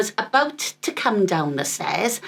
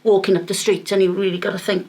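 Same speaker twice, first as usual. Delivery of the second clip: ordinary talk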